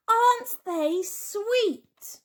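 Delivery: high, slow, doting coo; cooing over babies. A narrator's reading. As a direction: melt over the piglets. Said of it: "said Missus Tamworth".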